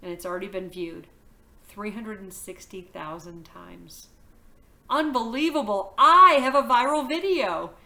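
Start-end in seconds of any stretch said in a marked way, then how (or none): 4.9-7.7 excited. A narrator's reading. Thrilled about viral video.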